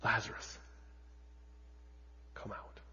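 a quiet hint of a command; hushed read quote. A narrator's reading. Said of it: "he cried out with a loud voice".

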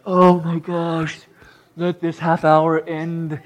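slow, drawn-out inner groan; hammed-up weary dread; mock exasperation. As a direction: drag it out as if begging for it to be over. Voicing dread during a bad lesson.

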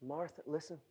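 ordinary talk, nothing marked

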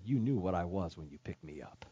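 a quiet, knowing hiss of a reply; snake's low final word; cold snake. A narrator's reading. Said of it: "slithered away and said".

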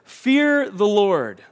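loud, high, drawn-out plea; pleading. Acted. Moses pleads with his people, urgent and imploring. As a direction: beg them, stretching each word slowly and forcefully. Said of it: "he's just pleading with them".

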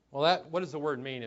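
ordinary talk, nothing marked

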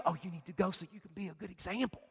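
soft, quick, coaxing devil voice; devil's voice. Retold or imitated. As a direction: whisper it like a sneaky devil. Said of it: "the devil's gonna tell you".

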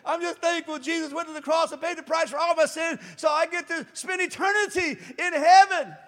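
mock sudden joyful testimony; growing conviction. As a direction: build it into a happy proclamation. Person finally says it fully.

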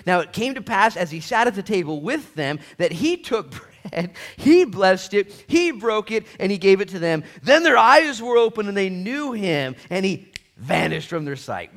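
loud, high scripture reading; booming sermon reading; preaching at full force. Acted. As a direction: proclaim the verses.

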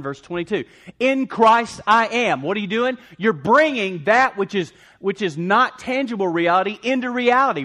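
loud, high, driving sermon delivery; full-force preaching. Real, not acted. Preacher rallying the congregation, loud and fervent. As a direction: preach it out, pounding each declaration.

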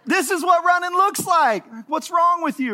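high, loud indignant yell; mock yell. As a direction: yell back defensively. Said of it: "I'm gonna yell".

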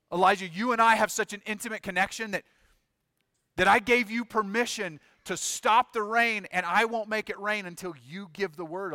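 loud, high, insistent remonstration; impassioned appeal. Acted. God pleading with Elijah, voice raised.

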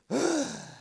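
high sharp mock gasp, falling away; imitated gasp. Father imitates his daughter's sigh at home, high gasp. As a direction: copy a daughter's little dramatic gasp. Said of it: "I'll hear her go".

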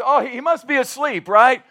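loud, high taunt edged with laughter; booming sarcastic tease; mocking. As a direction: jeer playfully with fake helpfulness. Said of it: "another time he mocks and says".

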